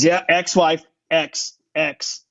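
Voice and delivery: "ex" picked out and repeated as a correction; stress on ex. Retold or imitated. Actor correcting the man testing him, stressing "ex". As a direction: lean on the "ex" in "ex-wife". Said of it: "Look at the X in there".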